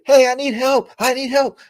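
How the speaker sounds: high-pitched mock damsel plea; wife calling for help. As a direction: imitate a wife flagging down riders. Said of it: "Make her do that".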